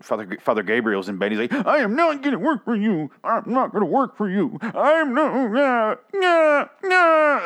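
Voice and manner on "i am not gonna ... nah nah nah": mock Eugene screaming. Eugene yelling at Father Gabriel, high mock wail. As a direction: wail it like a panicked man falling apart. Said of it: "starts screaming at".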